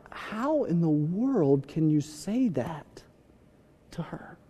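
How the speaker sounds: slow, hushed disbelief; softly trailing, acted dismay; appalled disbelief. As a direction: draw it out softly, aghast. Appalled reaction to arguing couples.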